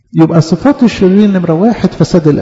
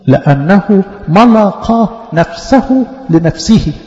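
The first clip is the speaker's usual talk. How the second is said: slow verse reading; 3 semitones higher, much slower than usual. Real, not acted. Repeats verse slowly, stressed.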